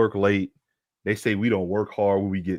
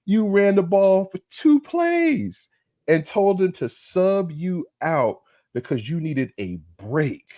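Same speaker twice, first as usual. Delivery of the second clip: 1 incredulous; 8 semitones higher, slower than usual. Real, not acted. Incredulous scolding, high slow.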